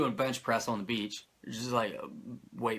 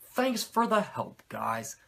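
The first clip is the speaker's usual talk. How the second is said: sarcastic; 7 semitones higher, slower than usual. Real, not acted. Sarcastic thanks to jobbers.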